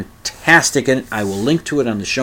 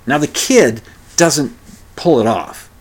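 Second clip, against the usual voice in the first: ordinary talk, nothing marked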